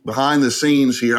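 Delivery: usual speaking voice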